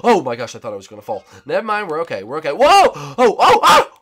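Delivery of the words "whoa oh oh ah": startled. Real, not acted. Nearly falling in game.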